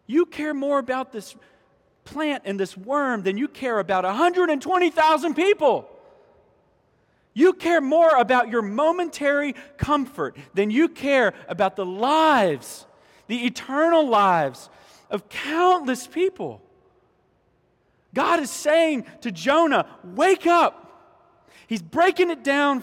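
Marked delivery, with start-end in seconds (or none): 0.1-16.6 raised, emphatic preacher's rebuke; forceful reproach. Retold or imitated. God rebukes Jonah over countless people, forceful reproach. 20.0-20.8 high, loud, punched command; urgent wake-up call. Retold or imitated. God is saying to Jonah, urgent wake-up call.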